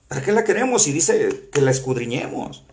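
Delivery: ordinary talk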